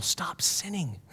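very soft, voiceless urging; hushed whisper to the room; whispered admonition. Real, not acted. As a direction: whisper it as a command.

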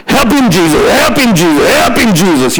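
mild mock yell, repeated like a refrain; imitated congregation yell. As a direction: imitate a worshipper calling out in church.

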